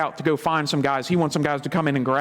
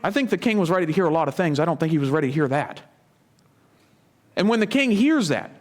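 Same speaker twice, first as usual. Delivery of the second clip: normal talking voice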